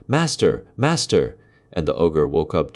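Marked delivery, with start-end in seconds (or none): 0.1-1.4 higher, loud cry of alarm from the harp; calling out loud. Acted. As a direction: cry for help to wake the master. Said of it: "the harp called out quite loud".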